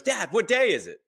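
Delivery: high, eager imitated child's voice; excited daughter. Daughter asking dad what day it is, imitated excitement. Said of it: "she's so excited. She goes".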